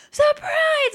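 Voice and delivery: very high-pitched party squeal; imitated surprise cheer. Friends jumping out at the party, acted high cheer. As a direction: re-enact springing the surprise.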